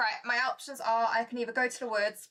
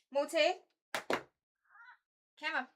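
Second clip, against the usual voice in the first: calling the cat; 6 semitones higher, much slower than usual. Real, not acted. Owner coaxes her cat onto her lap, sweetly.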